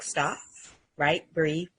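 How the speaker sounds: normal talking voice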